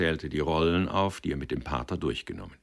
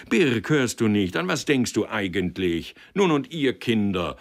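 louder, higher, insistent calling; prodding call; 5 semitones higher, 6 dB louder than usual. Acted. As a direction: shake the silent man out of his stupor. Director prods silent Birk.

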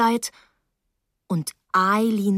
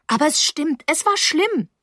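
high, insistent plea; upset girl insisting; distressed protest; 8 semitones higher than usual. Acted. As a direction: protest urgently to be believed. Girl protests she is believed.

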